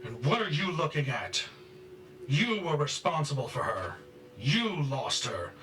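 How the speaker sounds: high, loud furious accusation; raging. Acted. Father blames mother for losing her, raging. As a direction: lash out, putting all the blame on her. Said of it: "better to let him rage".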